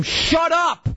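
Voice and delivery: high-pitched yelled outburst; exasperated high shout, imitating oneself; retold scream. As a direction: scream it as a sharp order. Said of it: "I always scream".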